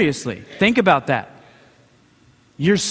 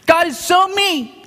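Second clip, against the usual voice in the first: put-on whiny toddler; 8 semitones higher than usual. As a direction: pout like a little child. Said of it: "stop being little Christians that".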